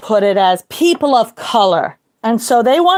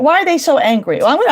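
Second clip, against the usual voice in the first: normal talking voice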